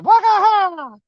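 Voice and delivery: high, hammed-up whoop; mock fish cry. Fisherman to viewers, voicing the fish with a whoop. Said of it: "he said".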